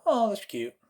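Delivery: high, mildly sweet approval; cutesy reaction. Acted. Viewer reacting to a meme, mock approval. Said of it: "Some will go".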